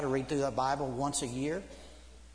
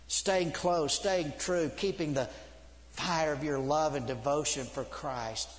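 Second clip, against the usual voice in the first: ordinary talk, nothing marked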